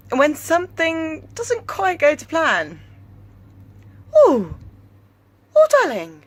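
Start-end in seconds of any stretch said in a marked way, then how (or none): 4.2-6.2 high, loud hooting ooh; little owl noise. Retold or imitated. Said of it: "It's like a little owl".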